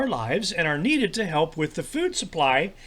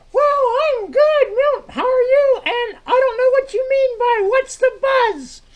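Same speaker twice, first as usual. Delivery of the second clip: high-pitched puppet sidekick; 6 dB louder than usual. Acted. Troy answers Milt's greeting in a very high sidekick voice.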